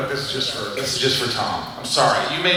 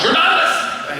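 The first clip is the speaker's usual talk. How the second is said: high-pitched mock protest; mock outburst; 11 semitones higher than usual. Actor mimicking the usual complaint, high mock outburst. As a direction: burst out in a high voice. Mock quote of how it always goes.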